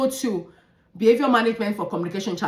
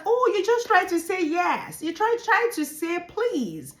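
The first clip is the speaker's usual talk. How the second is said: put-on higher, cheering praise for a child; excited praise; 8 semitones higher than usual. Shown as a demonstration. Teacher cheering a child's attempt to speak, higher and excited. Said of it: "like, shout, encourage".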